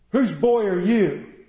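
loud, slow, looming demand; booming question. Retold or imitated. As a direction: loom over the boy and boom it out. Huge preacher confronting scared boy.

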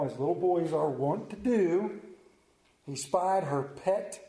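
usual speaking voice

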